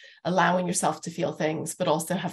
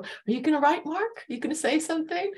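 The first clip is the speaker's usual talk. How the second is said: quoting herself; 10 semitones higher than usual. Retold or imitated. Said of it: "there is the moment where I say to you".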